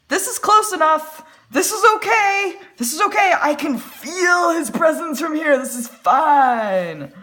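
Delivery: high, loud insistence from the cheap seats; giddy self-reassurance, loud and high; thrilled mock shout. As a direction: call it out high and loud, stretching the last word. Acting out herself thrilled in back row.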